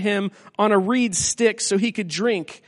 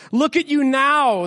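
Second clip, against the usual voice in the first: slow, higher taunt, a mild mock yell; 5 semitones higher than usual. A narrator's reading. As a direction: draw out the jeer. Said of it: "they yelled at him".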